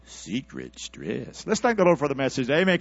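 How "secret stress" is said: low, slow hushed aside; preacher's slow hushed joke to the church. Acted. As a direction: drop it under your breath.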